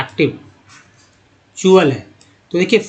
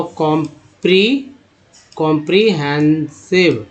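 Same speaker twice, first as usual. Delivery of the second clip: "Comprehensive" broken into slow syllables with pauses; slow syllable demo; much slower than usual. Shown as a demonstration. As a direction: sound out "comprehensive" piece by piece. शब्द धीरे तोड़कर पढ़ाना. ("Comprehensive" रुक-रुककर, धीरे)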